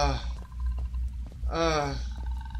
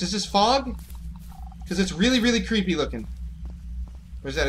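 ordinary delivery

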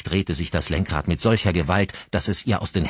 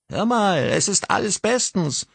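a higher voiced man saying it's all fine; soothing brother. Brother on phone playing it down, lightly voiced. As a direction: brush off her panic lightly.

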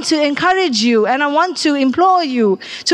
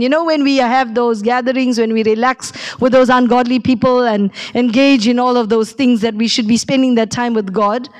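ordinary talk

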